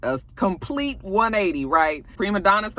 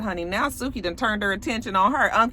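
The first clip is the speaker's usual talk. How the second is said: normal talking voice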